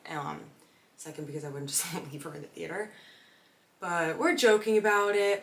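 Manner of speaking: usual speaking voice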